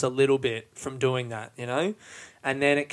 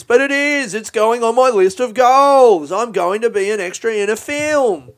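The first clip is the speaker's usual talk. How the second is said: silly voice; 8 semitones higher, 8 dB louder than usual. Real, not acted. Keeps the silly voice going.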